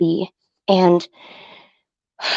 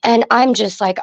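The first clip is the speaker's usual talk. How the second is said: ordinary delivery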